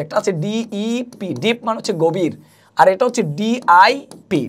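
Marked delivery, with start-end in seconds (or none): 0.4-1.3 letters spelled out very slowly; slow spelling. Shown as a demonstration. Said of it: "ডিপ কিন্তু দুটো আছে". 3.4-4.4 DIP spelled out very slowly, letter by letter; slow spelling. Shown as a demonstration. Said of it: "ডিপ কিন্তু দুটো আছে".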